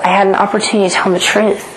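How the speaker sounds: ordinary talk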